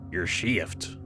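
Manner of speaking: dry, patient voice. Acted. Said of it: "It was dry, patient".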